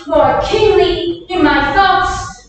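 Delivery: ordinary talk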